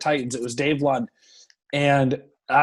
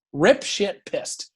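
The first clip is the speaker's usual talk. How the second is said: normal talking voice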